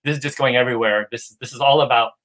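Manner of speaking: normal talking voice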